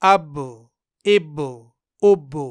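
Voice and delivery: اب with ب bouncing open; اب with a slight rebound; with qalqalah. Shown as a demonstration. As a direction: release the ب of اب into a faint echo. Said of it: "اس طرح".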